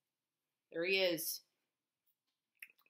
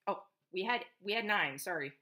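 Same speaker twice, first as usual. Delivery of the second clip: ordinary delivery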